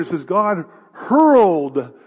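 hurled slow and loud; hurled stretched out with force; stress on hurled. Shown as a demonstration. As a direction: punch hurled, slow and strong. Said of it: "this is an active word".

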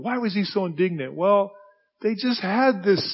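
usual speaking voice

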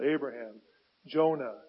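ordinary talk, nothing marked

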